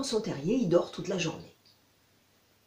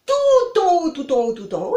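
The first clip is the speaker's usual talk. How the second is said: premier tout en haut très aigu et fort; 8 semitones higher, 9 dB louder than usual. Acted. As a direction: monter la voix sur haut. Fox points high tree. (renard qui insiste, très haut)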